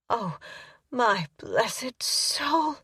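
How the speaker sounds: high, very slow, drawn-out cry; breathy drawn-out moan of release; ecstatic sigh. A narrator's reading. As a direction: stretch it out in rapture. Climax exclamation.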